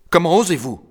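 high, loud, furious retort; ferocious outburst. A narrator's reading. Count furiously rebukes the collector for his accusation, outraged shout. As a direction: snap it out with outraged fury. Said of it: "fixa Mordechai Meisel avec férocité".

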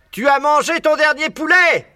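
cri aigu et fort; hurlant de rage. A narrator's reading. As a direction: hurler sa menace au renard. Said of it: "hurlait Boggies". (Boggies hurle sa rage au renard)

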